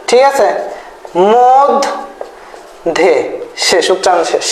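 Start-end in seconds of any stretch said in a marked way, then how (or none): 1.3-3.1 মধ, a long pause, then ধে; syllable by syllable. Shown as a demonstration. Teacher showing students how to write the sound, split in syllables. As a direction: split the word in two as it sounds. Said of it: "just যেভাবে উচ্চারণ করি".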